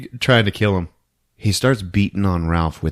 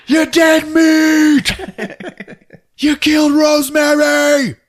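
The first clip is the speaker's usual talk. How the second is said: high, loud, enraged threat; accusing yell; 7 dB louder, slower than usual. Retold or imitated. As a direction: yell it like a man out for revenge. David threatens and accuses Ralph.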